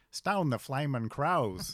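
put-on slow, loud, broad old-style Australian bushman; surprised old Australian slang, hammed up slow; old-fashioned Aussie exclamation. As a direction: drawl it out like an old-time Aussie battler. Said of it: "his accent was sort of like the Sullivans".

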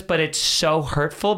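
usual speaking voice

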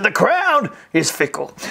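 Brian Houston impression. Coach imitating a famous preacher for students. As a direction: mimic the pastor's delivery. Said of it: "Brian Houston talks like this".

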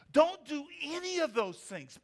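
sarcastic command. Acted. Sarcastic mock warning.

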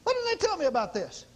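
high-pitched indignant grievance, acted; mock aggrieved question; half-mad complaint. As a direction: protest as if cheated by your church. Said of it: "You get about half mad".

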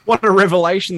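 loud, high mock wonder; sarcastic amazement. Real, not acted. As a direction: oversell the shock sarcastically.

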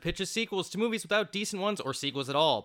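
rapid-fire excited host intro; talking fast. Real, not acted. Host welcoming listeners to a special follow-up episode, talking fast. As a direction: rattle off the welcome at speed. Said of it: "which is why I'm talking so fast".

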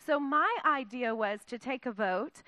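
normal talking voice

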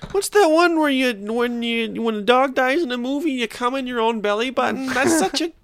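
high-pitched put-on mom voice; fan mom impression voice. Mom asking comedian for his joke, fan mom voice. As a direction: play the eager fan far up high.

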